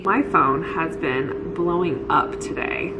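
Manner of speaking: normal talking voice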